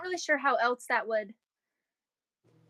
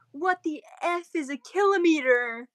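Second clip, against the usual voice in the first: mocking American; 3 semitones higher, 3 dB louder, slower than usual. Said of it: "I've seen the people that are like".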